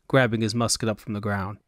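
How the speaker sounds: plain reading voice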